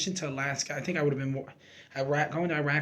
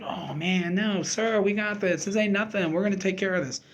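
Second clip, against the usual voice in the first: higher, louder, carefree reassurance to the colonel; laughing it off; 6 semitones higher, 7 dB louder than usual. Retold or imitated. Soldier brushing off his commander's worry, breezy and higher. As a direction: shrug it off like it's nothing, chuckling. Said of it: "I'm laughing".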